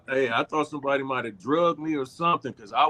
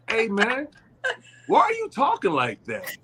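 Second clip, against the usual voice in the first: higher-pitched, puzzled challenge; retold baffled question; 7 semitones higher than usual. Cast member retelling wine-fuelled confusion to Andrew, baffled. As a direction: call out the odd voice, baffled.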